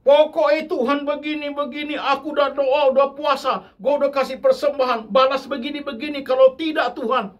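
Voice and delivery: high, loud, whiny insistent demand at God; the preacher mocks a believer's childish demanding prayer. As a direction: nag God like a spoiled child. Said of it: "Seperti anak kecil".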